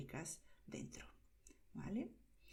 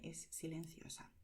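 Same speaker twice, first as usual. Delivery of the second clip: unvoiced whisper to show silence; hushed Es silenciosa; 3 semitones lower, 8 dB quieter than usual. Shown as a demonstration. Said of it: "la letra U es muda".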